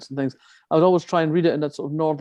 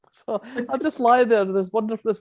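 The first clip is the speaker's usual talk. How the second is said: laughing; 7 semitones higher than usual. Real, not acted. Laughing while recalling German audiobooks.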